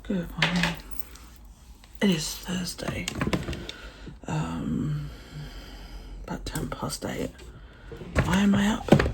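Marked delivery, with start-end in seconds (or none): 0.0-7.3 whispering. Real, not acted. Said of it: "early morning quiet vlog".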